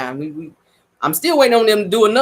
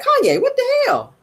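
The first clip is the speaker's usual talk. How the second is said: high disbelieving cry; incredulous high exclaim. Retold or imitated. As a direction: call out his name in disbelief. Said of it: "I was like".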